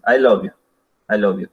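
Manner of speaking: I LOVE YOU level, no rise or fall; neutral tone. Shown as a demonstration. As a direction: repeat I LOVE YOU evenly, without enthusiasm. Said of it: "el neutral es así". (I LOVE YOU en tono neutro, sin ganas)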